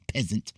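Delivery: "peasant" said by mistake for "present"; repeated tongue slip on "peasant"; fumbled word. Real, not acted. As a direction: trip over it, dropping the R into "peasant". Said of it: "stuttering and fumbling".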